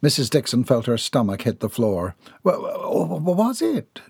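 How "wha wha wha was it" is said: stammering, higher and shaky with fear; trembling voice. Acted. Mrs Dixon learns of the letter, stammering higher in alarm. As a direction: stumble over the start, pitch rising in alarm. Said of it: "She tried to suppress the tremble in her voice".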